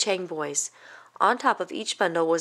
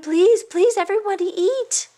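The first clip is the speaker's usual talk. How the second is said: overjoyed invitation; 12 semitones higher, 5 dB louder than usual. Acted. Mama overjoyed at the feast.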